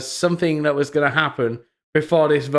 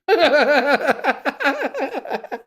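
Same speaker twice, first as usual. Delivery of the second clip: high laugh; 11 semitones higher than usual. Real, not acted. Laughing at the tweet.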